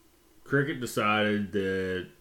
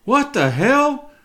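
high, loud, slow shocked shout; startled yell; 9 dB louder, much slower than usual. Retold or imitated. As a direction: yell like a boy seeing his face. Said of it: "I hear him go".

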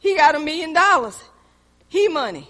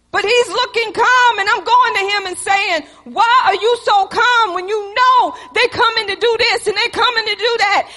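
high, loud half-crying yell; frantic shout; 5 semitones higher, 5 dB louder than usual. Retold or imitated. Wife shouting at her calm husband in a panic. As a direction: shout it out, near tears, exasperated.

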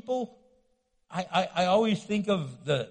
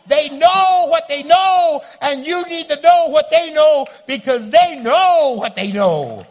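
loud, slow, insistent mock shout; mock know-it-all shout; 7 semitones higher, 13 dB louder than usual. Mocking the know-it-all Tigger friend, loud, slow mock shout. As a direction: hammer the point like a know-it-all.

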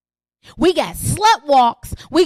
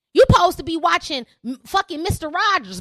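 ordinary delivery

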